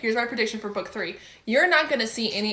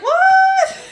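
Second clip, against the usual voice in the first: squeaking what, much higher and louder; a shrill squeak of disbelief; shocked what; 14 dB louder than usual. Retold or imitated. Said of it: "I was like".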